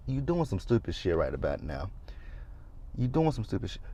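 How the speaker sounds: quiet warning to a friend on the phone; hushed warning. Retold or imitated. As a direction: lean in and warn her quietly. Warning Andy to hang up.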